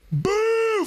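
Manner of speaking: long, high-pitched crowd boo; full-throated boo. Retold or imitated. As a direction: boo like a whole stadium. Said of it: "Full-throated".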